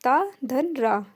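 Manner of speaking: slow, deliberate, each part separated; teacher breaking the conjunct into parts slowly; slow spelled-out breakdown. Shown as a demonstration. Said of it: "और त्रा".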